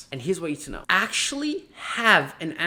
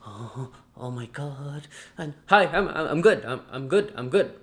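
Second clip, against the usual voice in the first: flustered stammering with a nervous laugh; mock nervous; 4 dB louder than usual. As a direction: play a jittery candidate tripping over words. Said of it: "go on the call nervous".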